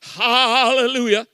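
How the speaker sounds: drawn-out demonstration hallelujah; slow, mock church shout; Pentecostal exclamation. Shown as a demonstration. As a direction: stretch it out like a revival preacher. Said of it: "Another Pentecostal wrapping".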